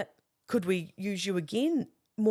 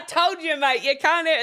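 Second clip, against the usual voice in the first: much higher, louder mate you can't have; mock bossy shout; 10 semitones higher, 7 dB louder than usual. Boss springing the prank on the visitor, mock loud shout. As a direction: burst in playing the stern boss.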